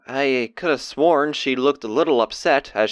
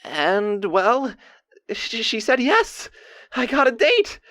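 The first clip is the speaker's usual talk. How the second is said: high, halting, bubbling announcement; giddy excitement; 9 semitones higher, slower than usual. Acted. Diarist revealing that she said yes, giddily excited. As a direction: stumble into the happy news, pitched up.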